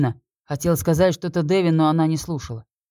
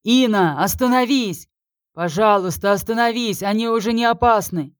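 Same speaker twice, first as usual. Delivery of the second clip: shouting over wind; 7 semitones higher than usual. A narrator's reading. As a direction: beg her to stop, yelling into the blizzard. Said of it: "стараясь перекричать ветер".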